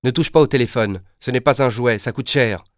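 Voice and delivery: a light scolding parent voice; mock-stern parent telling off; parents' warning. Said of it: "mes parents me répètent souvent".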